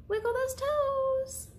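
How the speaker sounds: high, slow, singsong cheer; bright high call to little listeners; playful cheer. Acted. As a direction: coax the kids to wiggle along. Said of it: "so you can wiggle your toes".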